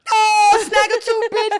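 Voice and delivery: high, loud schoolboy taunt; mocking yell. Boys at school mock her broken tooth, loud jeering yell. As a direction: jeer it like a rowdy schoolboy.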